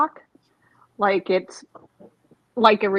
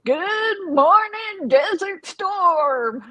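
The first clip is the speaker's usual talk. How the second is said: very high, slow, stretched radio greeting; radio DJ announcer; 10 semitones higher, much slower than usual. Retold or imitated. Teasing a friend about his voice, a slow mock radio DJ greeting. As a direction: boom it out like an army radio DJ. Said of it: "I could totally see you in the service".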